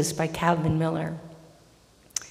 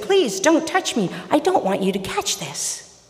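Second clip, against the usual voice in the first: startled; 9 semitones higher than usual. Acted. Startled leper pulls away from Jesus, alarmed plea. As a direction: blurt it high, then settle. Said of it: "The man, startled".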